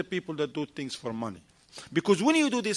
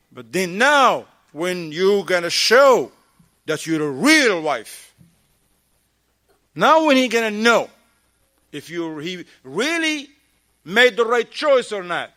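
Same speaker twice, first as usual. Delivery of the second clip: rising preaching; 8 dB louder, slower than usual. Real, not acted. Preaching build.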